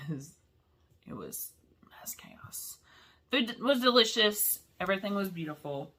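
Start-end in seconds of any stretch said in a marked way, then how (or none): 0.1-2.8 hushed whisper, almost no voice. Real, not acted. Telling viewers about the noisy party, in a whisper. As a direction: breathe it out like a secret.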